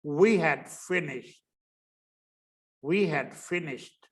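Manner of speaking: We had finished very slow, had reduced to a d; slow weak-form demo. Shown as a demonstration. Teacher modelling the weak form of had. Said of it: "वीक शब्द अगर बोलना है".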